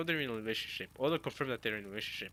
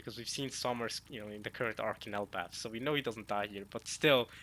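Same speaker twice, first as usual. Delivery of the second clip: normal talking voice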